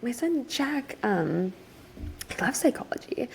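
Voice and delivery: breathy whisper, as if sharing a secret; confiding whisper about his son; 1 whispered aside. Retold or imitated. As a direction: lean in and whisper it. Dad name-drops his son.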